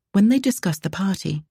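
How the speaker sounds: ordinary delivery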